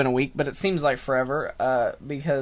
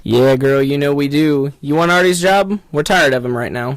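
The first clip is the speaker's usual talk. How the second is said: loud, smooth come-on voice; sleazy radio host; 8 dB louder than usual. Acted. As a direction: pitch her like a sleazy DJ. Radio host pitches Agnes.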